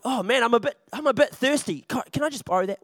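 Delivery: ordinary delivery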